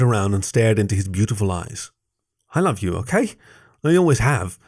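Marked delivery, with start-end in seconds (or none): 2.5-4.6 higher-pitched outburst, volume held back; mild shout. A narrator's reading. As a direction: blurt out the confession. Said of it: "I shouted".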